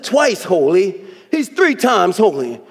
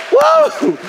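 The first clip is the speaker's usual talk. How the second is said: high excited whoop, real; preacher's ecstatic whoop; joyful high whoop; 14 semitones higher than usual. Real, not acted. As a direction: let out a whoop of praise. Preacher peaks in praise.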